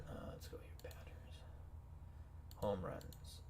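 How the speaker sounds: very soft, near-whispered self-talk; muttering to self. Real, not acted. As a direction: murmur it under your breath while searching. Talking to self while navigating stats.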